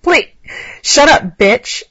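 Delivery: loud, harsh shout; yelling. A narrator's reading. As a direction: bark it out loud and rough. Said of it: "Alex yelled".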